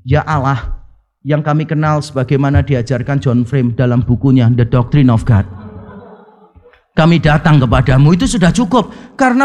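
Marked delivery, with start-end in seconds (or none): 0.1-8.2 low, understated prayer delivery; economical prayer demonstration. Acted. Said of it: "Saya akan berdoa begini".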